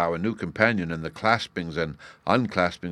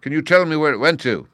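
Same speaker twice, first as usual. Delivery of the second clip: high, loud, urgent question; raised voice full of eager agitation; emotional cry; 9 semitones higher, 11 dB louder than usual. A narrator's reading. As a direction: beg desperately for the answer. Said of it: "he cried".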